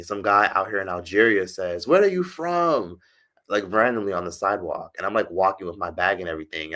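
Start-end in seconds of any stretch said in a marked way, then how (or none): 1.9-3.0 high, slow mock call from a stranger; mimicking a stranger. Stranger on the sidewalk calling to her, high mock voice. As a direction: play the guy on the street, pitched high. Street guy calling out question.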